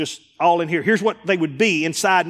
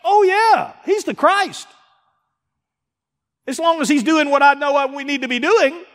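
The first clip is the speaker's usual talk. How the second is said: much higher eager voice; hammed-up Peter, high and keen; Peter answering; 11 semitones higher, 3 dB louder than usual. Preacher voices Peter's eager answer about Jesus, pitched high. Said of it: "Ask Peter".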